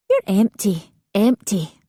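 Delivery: matter-of-fact. A narrator's reading. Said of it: "said Sheila matter-of-factly".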